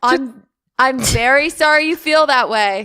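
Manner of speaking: loud, high mock condolence; hammed-up forced politeness; forced sympathy. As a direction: push it out as if it costs great effort. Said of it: "it's like it took every, like, inch of you".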